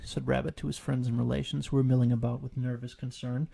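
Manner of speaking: ordinary delivery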